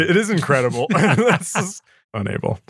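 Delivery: amused, high laughing delight. Real, not acted. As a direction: laugh in awe at how calm he is. Laughing at the pilot's calm.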